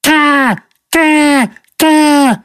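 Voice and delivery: high cawing bird squawk. Retold or imitated. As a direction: squawk it like a crow outside the window. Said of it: "he's just going".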